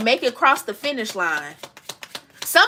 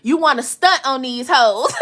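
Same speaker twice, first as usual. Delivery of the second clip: words dissolving into a long high laugh; 3 semitones higher than usual. Real, not acted. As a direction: laugh it out, amused. Laughs about revenge energy.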